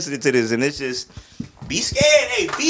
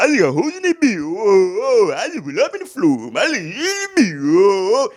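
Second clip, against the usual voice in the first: higher, rapped mimicry of the verse; 5 semitones higher than usual. Reviewer mimics [name]'s flow, higher and rapped. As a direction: rap it back like the track, pitched up with whoa ad-libs. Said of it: "The way I".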